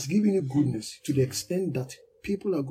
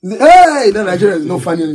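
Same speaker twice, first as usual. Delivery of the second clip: excited exclamation; 4 semitones higher, 14 dB louder than usual. Real, not acted. Laughing exclaim about the movie.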